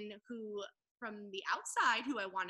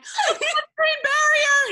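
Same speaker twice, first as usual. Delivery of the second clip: slow, much higher and louder panicked yell; freaking out shout; 19 dB louder, slower than usual. Retold or imitated. Recalling her panic on the doctor's call, a high alarmed shout. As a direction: blurt it out as a laughing alarm. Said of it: "Freaking out".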